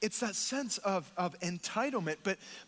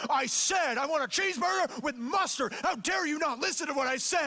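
raised, high-pitched angry customer rant; hostile outburst; 8 semitones higher than usual. Retold or imitated. Older gentleman berating the order taker, hostile and angry. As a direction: act out a customer blowing up over being misheard. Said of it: "such hostility and anger come out".

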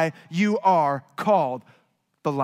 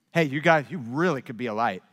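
mock casual encouragement; 7 semitones lower, 3 dB quieter, faster than usual. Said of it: "He doesn't say".

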